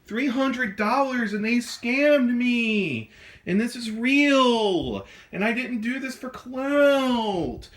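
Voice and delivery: high, slow wail of outrage; melodramatic, wailing scam-victim rant; overacted anger. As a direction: drag out each line's last word in hammy fury. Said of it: "Do you hear me acting?".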